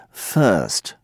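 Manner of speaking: first with no r, fuhst, slowly; British first. Shown as a demonstration. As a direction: drop the r in first. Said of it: "but British say".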